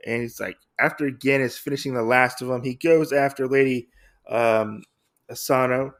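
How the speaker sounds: ordinary talk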